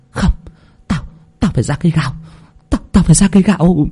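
choked, halting resolve, rising on the repeat; distraught stammer. A narrator's reading. Mother pushing past everyone to reach her daughter, distraught stammer. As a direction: stumble over the first words in shock.

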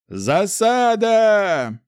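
high, loud, long-drawn battle shout; drawn-out roar of alarm. A narrator's reading. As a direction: bellow a warning to the whole troop. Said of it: "рявкнул Ардаль".

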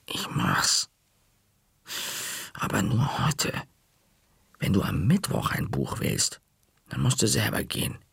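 whispered, reluctant concession; hissed whisper. A narrator's reading. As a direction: lay down terms under your breath. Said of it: "zischte ich".

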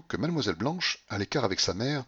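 ordinary delivery, nothing marked

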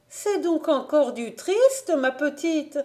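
a hint of a fretting aunt; worried aunt.

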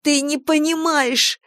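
high, slow, breathless outburst; gasping. A narrator's reading. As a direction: blurt it out, out of breath. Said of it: "задыхаясь, выпалила девушка".

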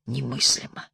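soft, low murmur; a hushed word, lightly voiced as a whisper; whispered disbelief. A narrator's reading. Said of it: "прошептал Егор".

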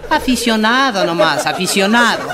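high, loud, slow mock cry; mock shout. Argentino cheering himself on, loud and high. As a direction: belt it out like a proud fan. Character boasting in joke.

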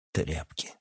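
low, soft scorn; quiet disdain; contemptuous. A narrator's reading. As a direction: toss the insult away under the breath. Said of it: "презрительно бросил Эдуард".

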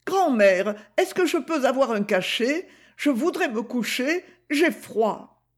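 lightly voiced young Gigi, protesting; Gigi, young girl. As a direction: play the girl, defiant and earnest. Gigi asks for pill.

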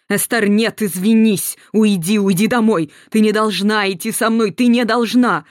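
громко, в отчаянии; кричит, умоляя дочь уйти; отчаянная мольба. A narrator's reading. Мать в отчаянии гонит дочь. (мать громко умоляет Эстер уйти домой)

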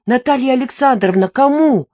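the narrator pleading loudly with the sleepy widow; insistent demand. Acted. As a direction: shake the answer out of her. Shaking the widow for answer.

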